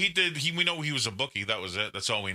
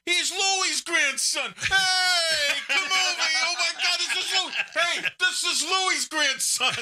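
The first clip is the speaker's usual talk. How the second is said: high-pitched, hammed-up uproarious greeting; excited shouted welcome; 4 dB louder, slower than usual. Men at the table discover Louis' grandson, excited shouting. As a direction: imitate a rowdy table hailing a long-lost relative.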